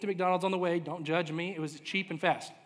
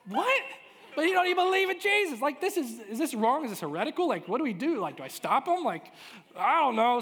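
high, loud, flustered disbelief; comic alarm, pitch leaping up; acted shock; 7 semitones higher, 6 dB louder than usual. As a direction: squawk in mock panic, question tumbling after question. Acting his shock at son prophesying.

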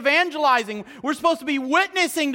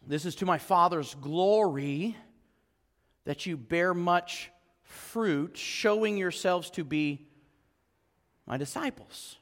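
slow, soft reading of John fifteen eight; quiet verse read to the listeners; scripture reading; 6 semitones lower, 7 dB quieter, slower than usual. Acted. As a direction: lay each phrase down gently. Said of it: "reading scripture slowly".

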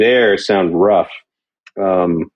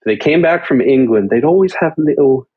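normal talking voice

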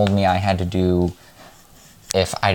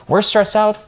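high mock voice; 13 semitones higher, 4 dB louder than usual. Mock men's plea.

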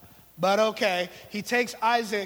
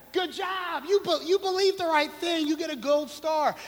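higher, patronising praise; mock praise; 7 semitones higher than usual. Preacher mocking believers who do nothing, higher. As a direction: pat them on the head mockingly.

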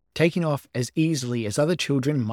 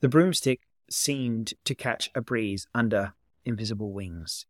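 plain reading voice

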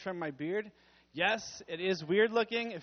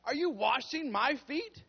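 1 shocked Peter; 7 semitones higher, 5 dB louder, slower than usual. Acted. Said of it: "And Peter said to him".

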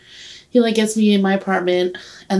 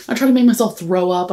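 usual speaking voice

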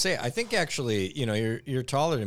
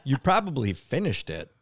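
a touch louder, mock scolding wife; wife's accusation; 6 semitones higher, 5 dB louder than usual. Retelling the wife's reply, a mild mock scold. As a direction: tease him like his wife did. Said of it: "but she said".

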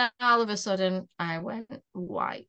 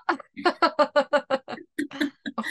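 loud, high cackling laugh; long rolling burst of laughter; 6 semitones higher, 6 dB louder than usual. Real, not acted. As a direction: crack up at a silly guess. Laughing at the wrong guesses.

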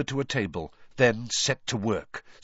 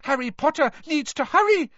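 very high, urgent elf voice; squeaky house-elf urgency; Dobby squeaking; 4 dB louder than usual. A narrator's reading. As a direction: squeak it out in a panic to hurry him. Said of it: "squeaked Dobby".

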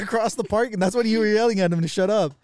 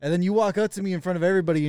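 usual speaking voice

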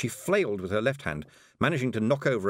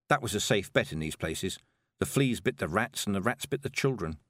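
ordinary delivery, nothing marked

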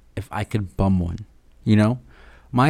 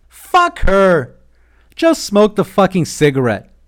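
much higher and louder, an angry inner devil shouting; louder angrier voice; 8 semitones higher, 7 dB louder than usual. Retold or imitated. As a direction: bark it like the devil on your shoulder. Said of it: "a louder, angrier voice".

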